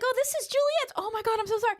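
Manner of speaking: high, flustered apology; flustered mom. Retold or imitated. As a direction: pitch way up, panicky sorry. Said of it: "I'm like".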